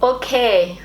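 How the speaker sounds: imitated German accent on "Okay"; German-style okay. Shown as a demonstration. English speaker copying how Germans say it, German accent. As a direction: say "Okay" as Germans do, both syllables full. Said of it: "I wanna sound more German".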